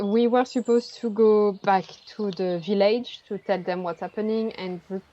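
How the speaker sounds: ordinary talk, nothing marked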